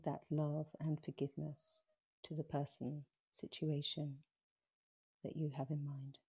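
slow, gentle meditation voice with long pauses; guided meditation. Acted. Guiding listeners through a forgiveness meditation, slow and gentle. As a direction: ease the listener through the breath hold softly.

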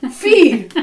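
high, loud laughing "Fee."; mock mispronunciation. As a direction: play the stranger saying "Fee". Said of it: "I'm like".

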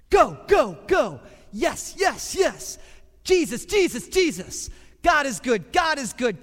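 loud, high shouts of praise; excited revel. Acted. Preacher leading the church in loud, high praise.

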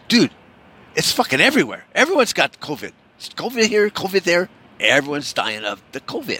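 mock-urgent, high-pitched gossip; alarmist friend. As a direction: play a pal breathlessly spreading big news. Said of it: "your friend's coming over".

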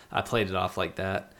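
normal talking voice

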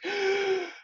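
high, drawn-in mock gasp; astonished gasp. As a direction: gasp as if amazed by an offer. Gaimon reacts to the invitation.

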